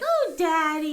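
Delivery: high, loud imitation of a kid; whining child. Daughter brushing off her dad's threat of school, whiny. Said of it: "you know, like that".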